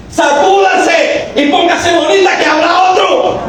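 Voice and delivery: high, slow, shouted; slow preacher's shout. Acted. Preacher shouting to the abandoned wife, slow and high. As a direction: drag out every word in a shout.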